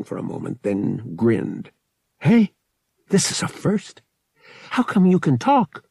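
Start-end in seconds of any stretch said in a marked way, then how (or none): 2.2-5.8 high, excited exclamation; pleased surprise. A narrator's reading. Pilot finds the droid can talk, excited surprise. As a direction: burst out, delighted by the discovery.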